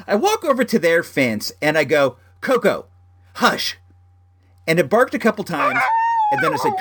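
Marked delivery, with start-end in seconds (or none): 2.4-3.8 short, falling command to a dog; firm dog-owner voice. Retold or imitated. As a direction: firmly hush the neighbor's dog, pitched up. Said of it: "I said it, like, in that firm".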